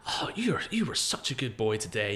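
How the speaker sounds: mock praise, starting high on the "Oh"; gushing American mother. Mother to her children at the airport, gushing praise. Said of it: "they're so positive".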